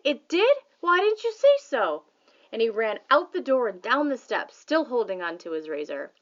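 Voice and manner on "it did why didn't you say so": high, loud, eager questions; excited shout. A narrator's reading. Father shouting at his son about the egg. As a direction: rush it out thrilled. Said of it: "Pop shouted".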